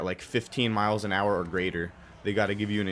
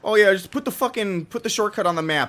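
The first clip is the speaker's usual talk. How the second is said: sarcastic rant; 10 semitones higher, 7 dB louder than usual. Real, not acted.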